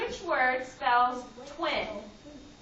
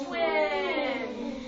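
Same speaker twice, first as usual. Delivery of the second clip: turtle slow; 2 semitones higher than usual. Shown as a demonstration. Teacher modelling for the class, twin stretched slowly. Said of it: "We say twin slowly like a turtle".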